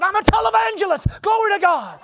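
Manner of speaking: high, loud preacher's cry; triumphant shout. Retold or imitated. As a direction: belt it out like a showy televangelist. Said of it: "the snake jumped up and said".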